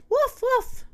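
two high little woofs; puppy bark. A narrator's reading. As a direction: yip twice like a puppy. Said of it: "she said".

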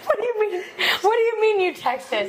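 high-pitched incredulous questioning. Real, not acted. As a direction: demand an explanation, half laughing. Incredulous at friend texting the word.

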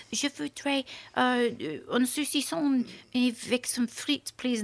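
put-on son's slow French accent; careful, halting French accent. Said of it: "Go do it in a French accent".

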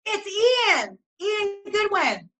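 high, drawn-out delighted cry; real fangirl delight, stretched out high; excited recognition. Real, not acted. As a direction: greet a favourite person's name with high-pitched glee.

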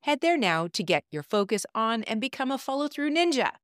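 upbeat promo read. Acted. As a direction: pitch it like a radio spot. Recorded promo for her program.

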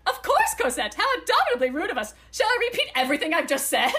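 loud, high, laughing fake courtesy; loud mock politeness. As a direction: shout the politeness sweetly. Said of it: "Saley grinned".

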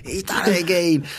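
a high, fed-up mock groan; mock annoyed. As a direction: lightly imitate an exasperated fan. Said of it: "he's so annoyed".

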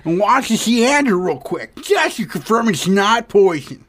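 a hint of Adam Sandler stand-up. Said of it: "I know that you're Adam Sandler".

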